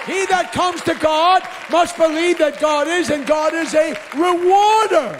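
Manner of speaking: shouted sermon, loud and high, hammering each line; full-force preaching. Acted. Preacher shouting to his congregation about why people came. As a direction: preach it hard to the back row.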